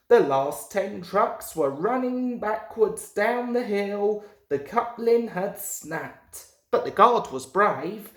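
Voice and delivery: ordinary delivery, nothing marked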